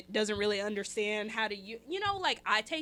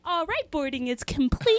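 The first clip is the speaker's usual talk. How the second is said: flight attendant; 5 semitones higher, 11 dB louder than usual. Retold or imitated.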